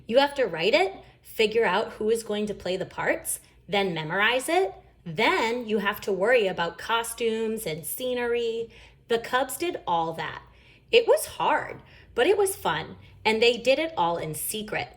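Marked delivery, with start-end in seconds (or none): none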